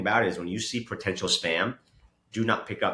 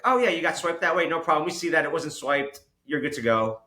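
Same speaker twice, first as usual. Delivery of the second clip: higher-pitched, casual reassurance; breezy bank clerk; 7 semitones higher than usual. Retold or imitated. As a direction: imitate an unfazed clerk waving it off. Acting out the unbothered bank clerk.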